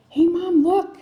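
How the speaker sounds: put-on high, loud little boy getting Mom's attention; young son calling. Little son showing his mom the night sky, high and loud. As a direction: call out like an eager small child. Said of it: "he's like".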